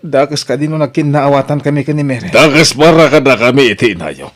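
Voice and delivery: loud, high protest. Acted. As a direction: insists loudly to his parents. Son confronts parents about lovers. (napigsa a panagprotesta)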